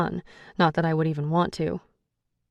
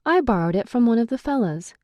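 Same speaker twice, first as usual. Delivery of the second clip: mock Irish accent; 5 semitones higher, 3 dB louder than usual. A narrator's reading. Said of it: "using his accent to mock him".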